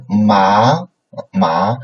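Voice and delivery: MA sliding upward; second tone. Shown as a demonstration. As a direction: lift the pitch steadily through MA. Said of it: "is second tone".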